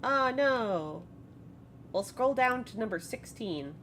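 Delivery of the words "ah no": lightly shrill little exclamation; a hint of a shrill voice. DM voicing a shrill voice through the stone, lightly. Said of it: "a kinda shrill voice".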